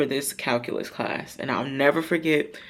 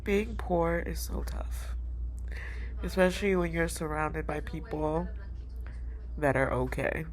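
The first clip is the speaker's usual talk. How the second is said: quiet sorrow; 8 dB quieter than usual. Real, not acted. Reflecting on poverty to viewers, soft and sad.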